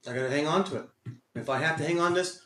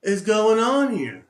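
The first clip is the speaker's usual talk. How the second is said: mock rant; 5 semitones higher, 9 dB louder, much slower than usual. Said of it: "ranting on there saying".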